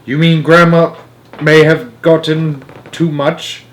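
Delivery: put-on rising worried boy's voice; anxious, high and tight. A narrator's reading. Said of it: "turning slightly pale".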